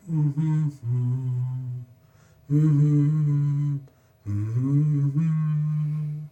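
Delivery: a drawn-out repeated hum; humming again and again, proud of it; imitating his own first hums. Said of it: "And so I was like".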